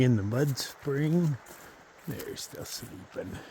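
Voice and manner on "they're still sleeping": whispering. Real, not acted. Whispering about sleeping hikers.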